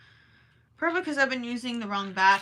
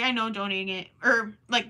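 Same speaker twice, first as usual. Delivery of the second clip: ordinary delivery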